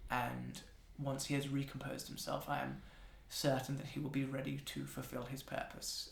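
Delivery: soft, low, measured apology; smooth things over quietly, with careful pauses; composed attendant. Acted. Voices the Focus, a composed woman apologizing.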